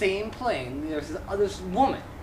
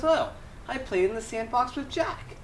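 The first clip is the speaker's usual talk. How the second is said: lightly voiced child, pitched up; a hint of a kindergartner, higher voice; little kid; 7 semitones higher than usual. Child telling teacher about recess, lightly childlike.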